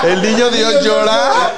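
words squeezed out through laughter; giggling as he repeats the joke; laughing. Real, not acted. Amused friend repeats the joke, laughing. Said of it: "Oye, qué cabrón".

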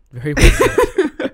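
grand mock applause, drawn out and giggling; hammed-up praise. Repeating praise to co-host as a high, slow, laughing ham.

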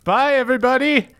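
high-pitched character sent to stay behind; Reynard. Acted. Reynard left behind with strangers, high character voice. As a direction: pitch it way up for an awkward goodbye. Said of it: "Reynard, you stay and".

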